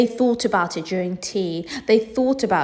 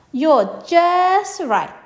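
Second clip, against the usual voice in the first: put-on high, slow, loud little boy; drawn-out sweet reassurance; child's voice; 11 semitones higher, 5 dB louder, much slower than usual. Luke voiced in story.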